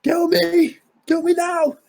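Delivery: very high mock pleading; squeaky, hammed-up demand; high-pitched goading. Host acting out a dare, high-pitched voice.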